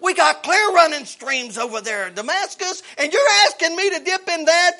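loud, scornful protest at the muddy river; offended general refusing the muddy river; indignant Naaman. Acted. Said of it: "This is what he's talking about".

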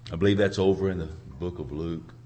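normal talking voice

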